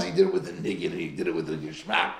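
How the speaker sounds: ordinary talk, nothing marked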